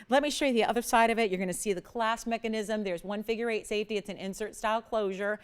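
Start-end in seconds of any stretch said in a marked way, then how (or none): none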